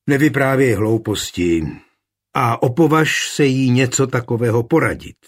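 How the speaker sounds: usual speaking voice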